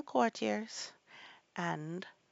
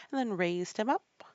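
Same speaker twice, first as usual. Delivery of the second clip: ordinary delivery, nothing marked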